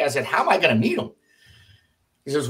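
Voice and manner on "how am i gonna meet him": retold bewilderment, pitched high; baffled question. Captain asks the colonel how to reach the general, baffled. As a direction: ask it higher, at a loss.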